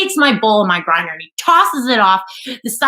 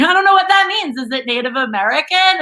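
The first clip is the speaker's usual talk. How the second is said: high, feigned cluelessness; playing dumb; 6 semitones higher than usual. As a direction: play innocent with a raised pitch. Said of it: "I'm playing dumb".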